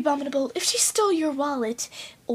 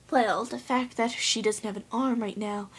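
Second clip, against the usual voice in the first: ordinary talk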